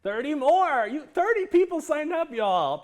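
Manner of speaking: thrilled. Real, not acted. Host thrilled by thirty sign-ups, excited near-shout. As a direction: shout the good news to the chat.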